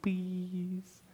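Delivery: put-on tiny, very soft toddler plea; toddler's please. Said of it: "and he'll go".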